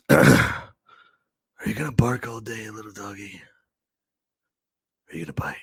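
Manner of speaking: very quiet, cool and unhurried; Michael Madsen. Acted. Host doing the movie tough guy's line, quiet cool impression. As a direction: drop to a low near-whisper with cool pauses. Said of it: "is my Michael Madsen impression".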